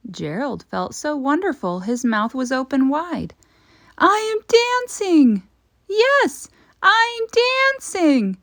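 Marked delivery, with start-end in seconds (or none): 4.0-8.4 very high, loud, ringing cries of joy; joyful cry. A narrator's reading. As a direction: shout it with pure delight. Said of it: "Gerald cried".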